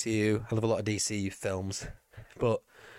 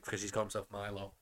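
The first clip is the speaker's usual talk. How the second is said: usual speaking voice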